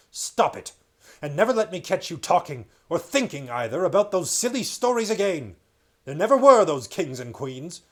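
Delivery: loud, raging scold; furious king. Acted. King Miraz furiously forbidding Caspian's stories. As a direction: pound every word at the boy.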